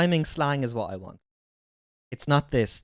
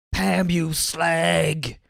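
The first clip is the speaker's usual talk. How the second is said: slow, drawn-out Cockney insult; mock Cockney; much slower than usual. Joking about The Office, drawn-out Cockney. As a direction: drag it out in a hammed-up Cockney.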